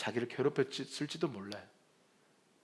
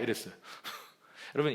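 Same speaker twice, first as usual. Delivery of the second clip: ordinary talk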